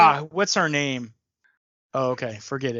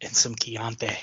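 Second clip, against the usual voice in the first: soft, creepy whisper; Hannibal Lecter whisper; 4 semitones lower, 5 dB quieter than usual. Retold or imitated. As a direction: savor it in a whisper. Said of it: "Hannibal Lecter".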